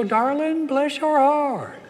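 high, slow, syrupy Southern mama voice; Southern mother. Retold or imitated. Mother consoling her son, high slow Southern sweetness. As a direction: coo it like a sweet Southern belle. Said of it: "she'd go".

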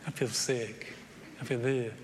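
low, soft mock whine about feeling sick; mopey complaint. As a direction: sulk like a runner dreading the race. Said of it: "I'm like".

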